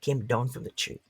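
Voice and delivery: lively storyteller narration; story narration. Acted. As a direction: tell it to a circle of listeners. Storyteller narrates folk tale.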